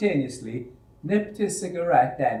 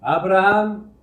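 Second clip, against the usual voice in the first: angry call; 7 semitones higher, 9 dB louder than usual. Acted. Foreman calls the charge hand.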